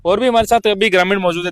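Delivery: normal talking voice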